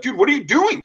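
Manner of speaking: high, shocked outcry at a buddy; retold disbelief. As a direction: play the stunned friend. Friends shocked at thrown tire.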